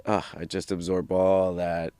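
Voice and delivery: normal talking voice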